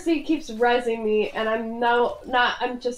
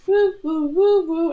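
imitated robot woo; 6 semitones higher, 4 dB louder than usual. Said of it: "Bastion keeps saying".

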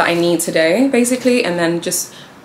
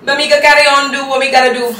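higher, louder Jamaican patois, resigned; Jamaican accent; 8 semitones higher, 8 dB louder than usual. Acted. Telling viewers she'll push on, in a Jamaican accent. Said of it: "my Jamaican accent".

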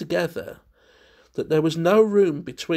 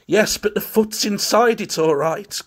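higher and louder, oddly strained; loud strange voice; 8 semitones higher, 7 dB louder than usual. A narrator's reading. Curtis to his friends over the shoe, loud and strange. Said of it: "in a loud, strange voice".

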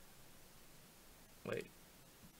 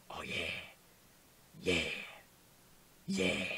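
low, whispery sultry crooning; mock sultry; 4 semitones lower, 6 dB quieter, slower than usual. Player admiring the makeover, mock sultry. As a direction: purr it softly, hamming up the seduction.

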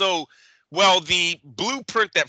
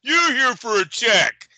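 higher-pitched imitation of a dismissive promoter; mock taunting promoter; 7 semitones higher than usual. Promoter belittling his own fighter's opponents to Tank, mock taunting. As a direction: mimic trash talk, dragging out the last jab. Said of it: "you're over here like".

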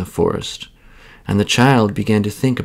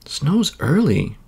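put-on child thinking; 6 semitones higher, slower than usual. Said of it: "thought the child".

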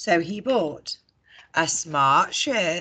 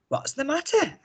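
George asking; 9 semitones higher than usual. A narrator's reading. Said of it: "asked George".

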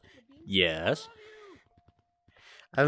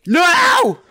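loud, high cry of no; dismayed shout; 13 semitones higher, 6 dB louder than usual. Real, not acted. Streamer getting tagged, shouting in dismay. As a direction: shout it as you get caught.

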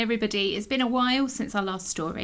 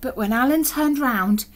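ordinary delivery, nothing marked

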